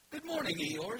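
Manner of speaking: high, bright little bear voice; cheerful Pooh. Acted. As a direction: greet him like a happy little bear.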